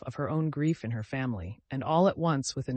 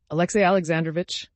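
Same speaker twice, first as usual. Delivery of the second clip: louder, urgent address of his name; desperate resolution; 4 semitones higher, 8 dB louder, faster than usual. A narrator's reading. Dolly confronting the cold husband, desperate and louder. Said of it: "with desperate resolution".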